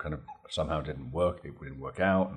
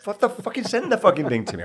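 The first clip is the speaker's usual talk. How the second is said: high, loud mock-frustrated outburst; half-laughing exasperated shout; exasperated yell; 11 dB louder than usual. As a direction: blow up in comic exasperation. Retold exasperation at teasing director.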